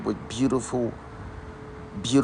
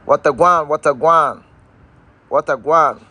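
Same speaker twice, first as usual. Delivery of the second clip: higher and louder playful Jamaican patois hello; Jamaican patois greeting; 3 semitones higher, 4 dB louder than usual. Acted. Greeting his Jamaican family online, in upbeat Jamaican patois. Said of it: "To my Jamaican family".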